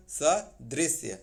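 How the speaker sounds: सदृश्य spelled out slowly with the final श्य; slow careful correct form. Shown as a demonstration. Teacher repeats the correct word slowly for students. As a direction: stretch सदृश्य out syllable by syllable. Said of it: "सदृश्य होता है".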